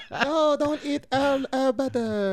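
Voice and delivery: high, slow, whiny imitation; mock high plea. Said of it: "Even that was passive aggressive".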